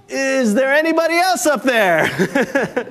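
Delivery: high, slow, pleading call; desperate cry. Acted. Hiker refuses to let go and calls for another helper, high and slow. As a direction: cry out for someone, anyone else. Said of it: "then the man cried out again".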